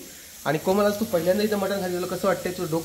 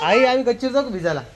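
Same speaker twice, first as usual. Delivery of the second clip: higher-pitched call to another room; 6 semitones higher than usual. Real, not acted. Son asking mother about the terrace, calling out. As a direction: call out the question to your mother.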